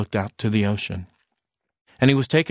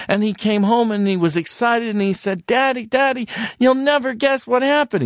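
excited; 10 semitones higher than usual. A narrator's reading. Excited narration into child voice.

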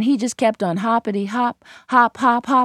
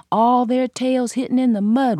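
ordinary delivery, nothing marked

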